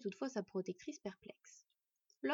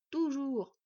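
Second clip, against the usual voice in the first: higher, louder, haughty insistence; 4 semitones higher, 5 dB louder than usual. Acted. Smith insisting to his wife, haughtily. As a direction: pronounce it grandly, as final. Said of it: "avec hauteur".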